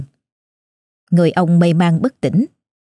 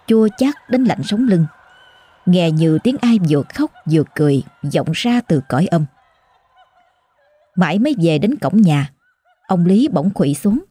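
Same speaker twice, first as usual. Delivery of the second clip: usual speaking voice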